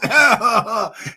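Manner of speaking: loud, high imitation of a wheezing laugh-cough; old man cough. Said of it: "He did the old man cough".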